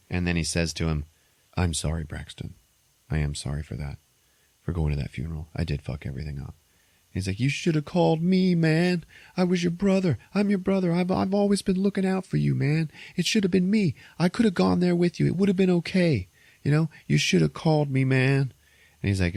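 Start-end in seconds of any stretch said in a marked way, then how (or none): 1.6-6.5 a low, soft apology to the brother; quiet apology. Retold or imitated. 7.4-18.5 high, aching reproach; a wounded younger brother, pitched high; hurt brother. Retold or imitated.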